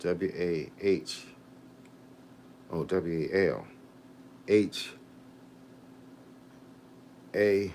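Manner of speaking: very soft, low, slow letter-by-letter spelling; spelling to self. Real, not acted. Vlogger looking up a viewer's town on the phone, spelling quietly to self. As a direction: spell it out under your breath, slowly.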